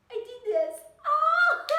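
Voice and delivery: high, loud squeal of delight; elated outburst. Acted. Speaker acts out delight at a finished masterpiece, elated. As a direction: burst into a loud, high-pitched cheer.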